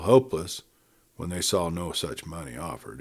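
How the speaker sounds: plain reading voice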